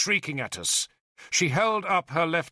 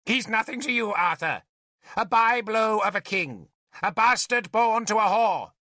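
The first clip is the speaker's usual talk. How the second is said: raised, insistent, scornful shouting; 8 semitones higher than usual. A narrator's reading. Merlin dismissing the child to Arthur, shouting. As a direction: call the scorn down from above. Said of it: "Merlin shouted from the ladder".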